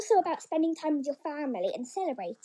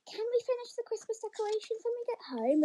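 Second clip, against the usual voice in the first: put-on child voice; 8 semitones higher, 3 dB quieter, faster than usual. A narrator's reading. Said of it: "replied another one".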